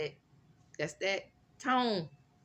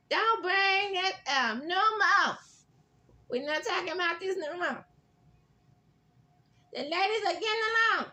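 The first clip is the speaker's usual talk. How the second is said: mock sing-song Gregory; 13 semitones higher, 3 dB louder than usual. Vlogger mocking Gregory brushing off the ladies' feud, high sing-song. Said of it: "Gregory was like".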